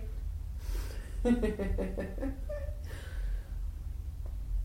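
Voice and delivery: soft breathy teasing laugh. Acted. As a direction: let out a quiet wicked laugh.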